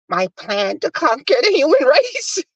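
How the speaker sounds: high-pitched Disney villain gloating; cartoon villain. Mocking her plan to conquer humanity, high cartoon villain. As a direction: imitate an evil schemer, breaking into laughter. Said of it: "like the evil villain in a Disney cartoon".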